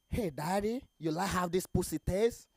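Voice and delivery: ordinary delivery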